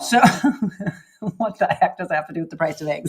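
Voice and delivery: laughing through the question. Real, not acted. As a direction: ask it while giggling. Laughing at egg joke.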